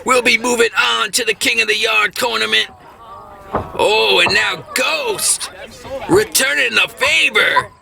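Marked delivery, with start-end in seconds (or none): none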